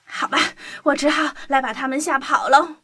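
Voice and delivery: plain reading voice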